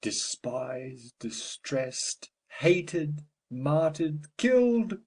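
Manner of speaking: builds from hushed to a full anguished shout; grieving cry. Acted. Father over his dead daughter, building to an anguished shout. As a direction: let the grief break out on killed.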